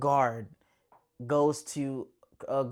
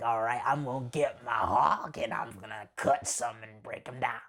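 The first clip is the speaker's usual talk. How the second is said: lazy Lobo threat; 3 semitones lower than usual. Retold or imitated. Lobo plotting revenge.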